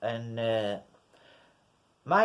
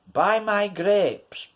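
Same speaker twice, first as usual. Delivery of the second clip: mock pushy seller, higher, slow; pushy street seller; 7 semitones higher, 4 dB louder, slower than usual. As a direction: lean on it like a pushy hawker. Pushy grape seller demands.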